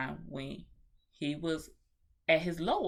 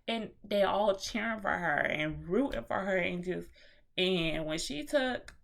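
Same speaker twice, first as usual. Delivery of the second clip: ordinary talk, nothing marked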